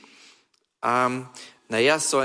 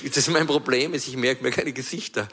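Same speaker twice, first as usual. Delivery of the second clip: ordinary talk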